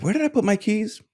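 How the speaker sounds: mock a forgetful person wondering aloud; puzzled forgetfulness. Doctor voices someone starting to lose memory, puzzled and high.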